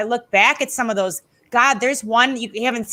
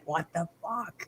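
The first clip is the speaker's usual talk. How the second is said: very soft, lowered disbelief; hushed mock astonishment; retold stunned reaction; 4 semitones lower, 15 dB quieter than usual. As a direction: imitate stunned onlookers under your breath. Stunned wrestlers react to disguise.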